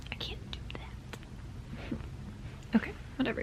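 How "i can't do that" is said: slow hushed whisper. Real, not acted. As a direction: keep the secret in a whisper. Kann das Buch nicht verraten.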